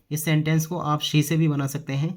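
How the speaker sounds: normal talking voice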